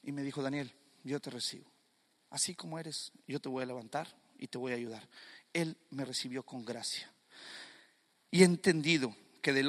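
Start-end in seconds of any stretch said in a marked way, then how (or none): 0.4-5.1 low, very soft and gentle; tender reassurance. Acted. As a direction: receive someone softly, as God would. God gently receives him, retold.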